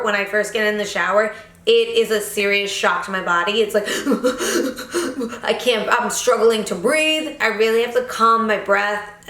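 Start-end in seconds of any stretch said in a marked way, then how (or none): 3.9-5.4 a high, breathless laugh at the cold; gasp-laugh at the freezing water; shocked laugh. Real, not acted. Describing the cold shower, a high startled laugh.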